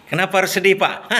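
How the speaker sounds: a light laugh through the words; amused, easygoing reply; laughing answer. Retold or imitated. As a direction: chuckle it off as if it's no problem. The errand boy answers the boss laughing.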